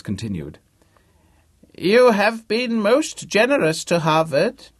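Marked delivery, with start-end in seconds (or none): 1.8-4.7 put-on high, loud, slow old-man voice; crotchety old man. A narrator's reading. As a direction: ham up a doddery ancient benefactor. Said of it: "his voice loud, old, and very convincing".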